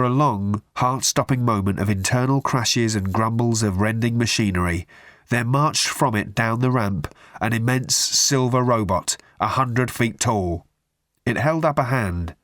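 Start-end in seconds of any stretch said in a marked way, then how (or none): none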